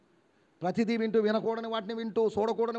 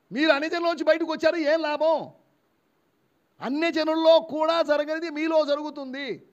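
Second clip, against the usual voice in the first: loud, high sermon delivery; forceful preaching rebuke; 8 semitones higher, 7 dB louder than usual. Real, not acted. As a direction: rebuke the congregation at full voice.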